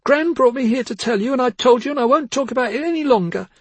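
high loud outburst; loud distraught shout. Acted. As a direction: shout her refusal. Girl shouts refusal.

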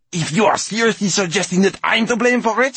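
very high, loud, indignant squeak; little voice. A narrator's reading. Glass man refuses the blame, tiny indignant voice. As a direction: protest as a tiny affronted creature. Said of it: "said Rosenquartz in his little voice".

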